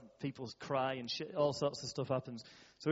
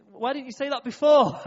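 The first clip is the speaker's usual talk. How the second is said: much higher and louder, incredulous; surprised exclaim; 9 semitones higher, 12 dB louder than usual. Retold or imitated. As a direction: throw the question out in disbelief. Retelling his reply to the story.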